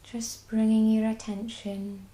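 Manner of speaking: ordinary delivery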